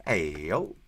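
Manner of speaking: ordinary talk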